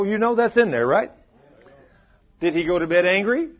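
ordinary delivery

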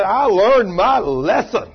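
loud, slow, every word weighted; emphatic acted vow. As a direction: proclaim "learned my lesson" loudly and slowly, word by word.

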